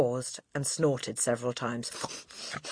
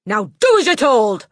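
high, loud cutting-off command; domineering headmistress, threatening; 7 semitones higher, 13 dB louder than usual. A narrator's reading. Headmistress silencing the protesting boy, loud and threatening. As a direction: threaten him at full volume.

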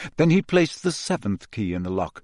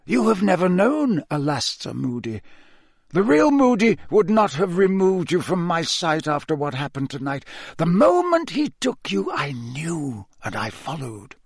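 put-on grave old headmaster; 5 semitones higher than usual. Dumbledore explains the impostor to Harry, grave and emphatic. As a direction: explain firmly, then let the voice drop.

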